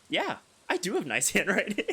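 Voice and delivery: "Yeah, I do have nice handwriting" said high and loud with a laugh; smug bragging. Shown as a demonstration. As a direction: brag about "have" like a show-off, laughing. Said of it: "Okay, maybe not like that".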